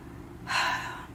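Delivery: long breathy sigh of dread; heavy sigh. Real, not acted. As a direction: sigh out the worry. Dread in the car.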